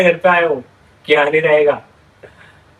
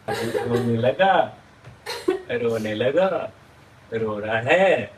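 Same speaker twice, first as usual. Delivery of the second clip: mock teasing; 8 semitones lower, 8 dB quieter, much slower than usual. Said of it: "teasing her crying".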